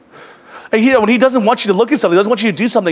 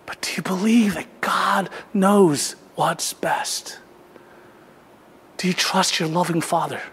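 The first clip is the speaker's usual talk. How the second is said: slow, near-whispered appeal to the congregation; soft, weighty question from the pulpit; hushed preaching; 3 semitones lower, 8 dB quieter, slower than usual. Real, not acted. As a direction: drop low and let each word land.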